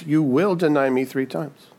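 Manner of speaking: "will" leaned on firmly, not "might"; stress on will. Shown as a demonstration. Preacher contrasting will with might, stressing "will". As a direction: make "will" the certain word.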